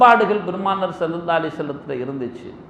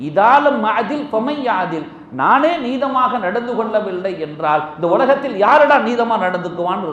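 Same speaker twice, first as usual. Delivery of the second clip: raised, louder retold outburst; boiling anger; 4 semitones higher, 4 dB louder than usual. Prophet asking who is just if he isn't, retold in loud anger. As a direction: demand it indignantly, high and forceful, easing at the end. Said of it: "கோபத்தோடு கொப்பளித்தார்கள்".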